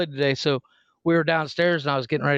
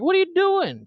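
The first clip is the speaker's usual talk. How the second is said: alarmed dad; 14 semitones higher than usual. Retold or imitated. Dad catching daughter hacking a tree with a knife, high alarmed. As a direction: call out in disbelief. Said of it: "I'm like".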